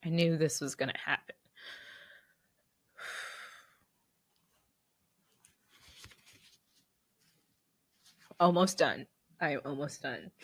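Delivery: soft, tear-choked voice; still crying. Real, not acted. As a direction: push through the last words while crying. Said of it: "on the interwebs crying".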